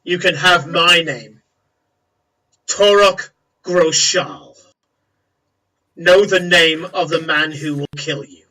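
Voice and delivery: loud, declaimed orc challenge; forceful, deliberate orc boast; proud menacing orc. Acted. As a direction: announce your name as a threat, with weighty pauses.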